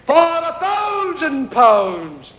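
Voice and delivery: high, very slow called-out offer; ringmaster. Retold or imitated. Ringmaster upping the prize to the crowd, slow grand call.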